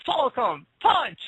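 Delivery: high, slow battle cry after a gasp; Falcon punch shout. Pretend. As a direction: shout the move name like a fighter. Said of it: "Show us you can do a proper Falcon".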